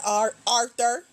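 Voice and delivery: slow teasing call of Arthur, rising at the end; drawn-out name call. Acted. Host calling out a lurking viewer, slow and teasing. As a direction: stretch out "Arthur" slowly with a teasing rise. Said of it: "I said your name just like that".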